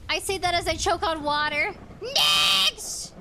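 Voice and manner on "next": loud call for the next one; booming Next; shout. Acted. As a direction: call out to the line. Calling next survivor in game.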